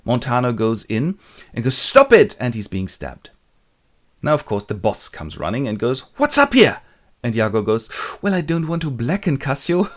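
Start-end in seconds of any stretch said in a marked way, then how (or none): 1.8-2.4 high, loud command; hammed-up urgent cry; intervening shout. Montano breaks up a fight. 6.2-6.8 high, loud shouted question; hammed-up alarmed authority; boss's demand. Boss comes running in. 8.2-9.8 higher-pitched coy hedging; mock-innocent, pitched up; feigned reluctance. Iago feigns reluctance to accuse.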